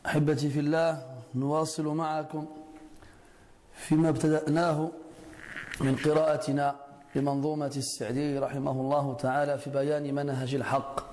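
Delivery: brisk formal Arabic opening, rattled off. Acted. Teacher opens the lesson to his students, brisk formal tone. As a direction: race through the opening praise.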